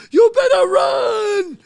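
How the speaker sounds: long, high, loud shout; panicked shout. Retold or imitated. Fleeing guy to the teller, panicked slow shout. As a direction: bellow the warning high, stretching it out. Fleeing man warns him.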